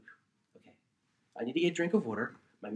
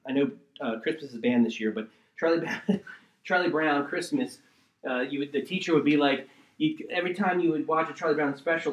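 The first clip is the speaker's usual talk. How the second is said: normal talking voice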